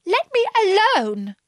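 high-pitched indignant plea. A narrator's reading. Alice to the pigeon beating her, indignant. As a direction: tell them off in a high voice. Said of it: "said Alice indignantly".

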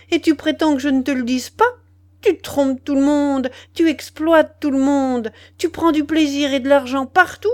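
loud, high-pitched outraged tirade; outraged, piling up reproaches; indignant revolt. A narrator's reading. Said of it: "Elle se révolta contre cette indignation".

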